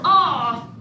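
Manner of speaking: ordinary delivery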